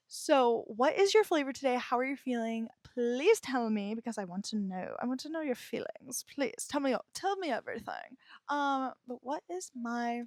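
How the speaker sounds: usual speaking voice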